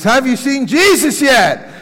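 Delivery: high, loud demanding question; exasperated pastor shout. Retold or imitated. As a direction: yell it as the pastor losing patience. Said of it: "He says".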